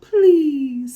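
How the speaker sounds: high, loud begging please; monkey pleading. A narrator's reading. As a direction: beg for your life. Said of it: "said Monkey".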